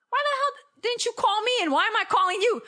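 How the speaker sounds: high, loud indignant demand; hammed-up exasperated questioning; mock scolding. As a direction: scold mom in playful outrage. Acts scolding mom on phone.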